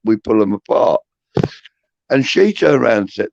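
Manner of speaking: normal talking voice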